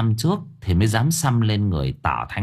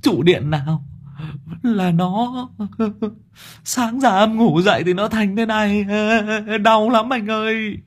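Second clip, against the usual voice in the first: tearful whimper; 12 semitones higher than usual. A narrator's reading. Sáu Bưởi complaining of pain to his boss, tearful. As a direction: sob out a pitiful complaint. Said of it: "Sáu Bưởi mếu máo".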